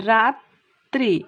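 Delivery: slow demo stretching the doubled ত in রাত্রি. Shown as a demonstration. Teacher to students, slowly showing the doubled ত in রাত্রি. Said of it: "তাহলে দেখো কেমন হলো".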